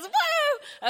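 high, drawn-out "woo"; imitated whoop. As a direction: mimic a churchgoer's high whoop. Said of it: "Gerald usually goes".

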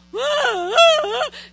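loud wailing cry, hammed up; mock baby bawl. To her husband on Christmas morning, a mock crying wail. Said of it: "I do the baby bawl".

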